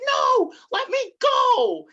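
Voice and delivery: high, loud, drawn-out struggle cry; imitated scream. Mother fighting the cops, hammed-up drawn-out scream. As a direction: scream it slowly, mock desperate. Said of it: "screams like".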